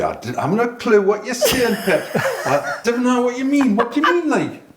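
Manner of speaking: high, laughing Geordie confusion; Geordie accent. Retold or imitated. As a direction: play dumb in broad Geordie. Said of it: "and I'll just say".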